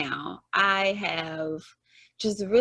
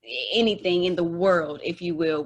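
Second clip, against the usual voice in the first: normal talking voice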